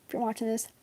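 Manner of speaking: quiet pointed address; hushed repeat. Real, not acted.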